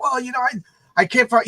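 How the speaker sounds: high, halting excuse; mock-flustered, stumbling explanation; flustered teen. Jimmy explains lost keys to Lemmy, flustered.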